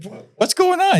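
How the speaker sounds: high-pitched, baffled imitation; clueless bewilderment. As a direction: act out someone with no idea what's happening. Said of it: "he had no idea".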